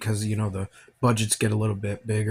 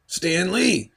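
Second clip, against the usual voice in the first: excited; 9 semitones higher, 3 dB louder than usual. Real, not acted. Spots Stan Lee's cameo on screen.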